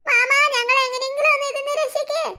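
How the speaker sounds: put-on a girl stuck in a pot begging her uncle for rescue; pleading girl. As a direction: beg the uncle like a little girl.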